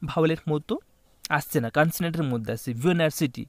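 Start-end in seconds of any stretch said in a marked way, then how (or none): 2.7-3.5 ভিওনার্সিটি said with a y-glide at the start, like yoo-niversity; teacher modelling ভিওনার্সিটি with an opening y sound; pronouncing university. Shown as a demonstration. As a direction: sound ভিওনার্সিটি as yoo-ni-ver-si-ty, consonant y first. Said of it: "ইউ আমরা যখন উচ্চারণ করব".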